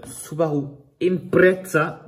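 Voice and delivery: playful fake Spanish-Italian accent; mock foreign accent. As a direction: roll Subaru Impreza out in a jokey Spanish or Italian accent. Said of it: "désolé pour mon accent".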